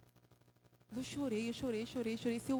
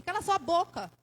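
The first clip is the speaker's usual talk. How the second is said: sharp raised cut-off, louder and higher; retold judge's shout; 5 semitones higher, 5 dB louder than usual. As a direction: imitate a judge yelling someone silent. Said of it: "a juíza gritava comigo".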